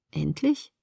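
soft, low questioning "Endlich"; puzzled echo. Acted. Daughter just awake questions mother's word, soft puzzled echo.

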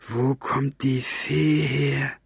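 very slow, drawn-out threat; menacingly calm. A narrator's reading. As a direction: interrogate slowly, with controlled menace. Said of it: "mit bedrohlich ruhiger Stimme".

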